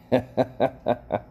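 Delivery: quick high laugh; high giggle. Real, not acted. Host cracking up about Limpy, high giggle. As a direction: giggle at your own joke.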